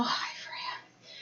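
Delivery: whispered hello to the dog; whispering. Real, not acted. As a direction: breathe the name in a whisper. Greeting pet quietly.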